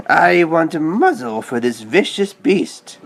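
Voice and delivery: a hint of a haughty aunt, slow and pointed; lightly voiced stern lady demanding, deliberate; stern old aunt. Aunt Sarah demanding a muzzle from the clerk, stern and slow. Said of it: "she told the sales clerk".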